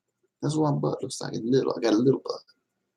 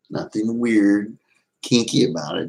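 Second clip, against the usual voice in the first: ordinary talk, nothing marked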